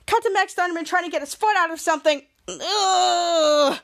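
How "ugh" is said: long put-on grunt of effort; drawn-out mock groan; straining groan. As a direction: imitate struggling to pull free. Imitates straining to free a foot.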